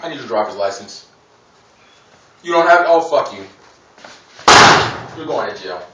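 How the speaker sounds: a dismissive police officer; mocking cop voice. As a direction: drone it like a bored cop. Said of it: "the way they talk to you".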